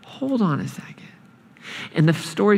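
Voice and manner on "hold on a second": soft, whispering hold on; hushed calming. Retold or imitated. God quietly calming angry Jonah, whisper. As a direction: gently shush him. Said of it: "And God says".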